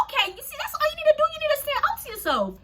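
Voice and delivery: high, excited self pep talk; confident pep talk. Retold or imitated. To herself after standing up to bullies, high excited pep talk. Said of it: "I felt mad confident".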